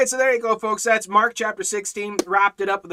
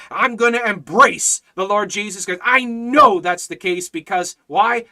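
loud, high-pitched fervent testimony; full-force preaching; 6 semitones higher, 7 dB louder than usual. Real, not acted. Preacher testifying that he is saved, full-force preaching. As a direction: proclaim it with total certainty, barely pausing.

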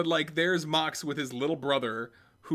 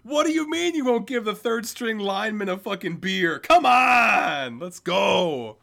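loud, higher mock outrage; mock indignant shout; 8 semitones higher, 9 dB louder, slower than usual. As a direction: yell it like a cheated third-stringer. Mock complaint as benched lineman.

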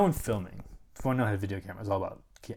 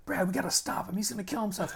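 higher, urgent, worried voice; panicked plea; 10 semitones higher, faster than usual. Retold or imitated. Begging Brad to stop Robbie, high and panicked. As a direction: beg him to stop it before it's too late.

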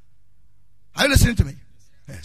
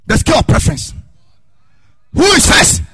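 loud, high, driving sermon; preaching at full force; 6 semitones higher, 13 dB louder than usual. Acted. Pastor shouting his points to the congregation, full force. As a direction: hammer each point home.

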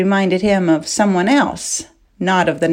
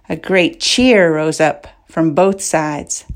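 ordinary delivery, nothing marked

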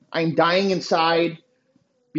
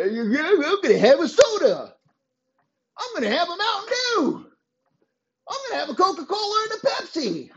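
higher, fast dopey impression; mock dumb voice; 11 semitones higher than usual. Mocking the soda-drinking fan, high and dopey. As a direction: squeak it out like a clueless slob.

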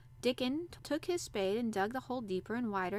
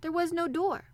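crying out; 8 semitones higher, 6 dB louder than usual. A narrator's reading. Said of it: "cried Colin".

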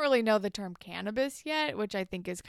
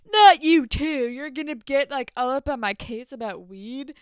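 loud, higher-pitched mock protest, easing off; exasperated comeback; 5 semitones higher, 11 dB louder than usual. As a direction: imitate a friend fed up with nagging, starting loud. Retold quarrel over weed smoking.

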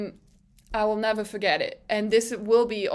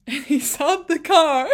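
real giggles breaking into the words; laughing; 5 semitones higher, 4 dB louder than usual. Real, not acted. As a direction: talk through bursts of laughter. Laughing while retelling the radio moment.

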